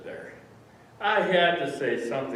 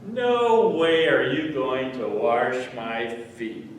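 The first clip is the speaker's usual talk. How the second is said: slow, loud, emphatic protest; loud refusal; 8 dB louder, slower than usual. Acted. Peter objects to Jesus washing his feet, loud refusal. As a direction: refuse outright, drawing out each word.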